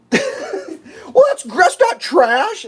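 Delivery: slow, high put-on voice with laughter; mock dimwit. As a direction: mimic a fool, high and dragged out. Mocking the stupid official.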